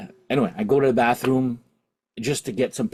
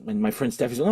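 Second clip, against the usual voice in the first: normal talking voice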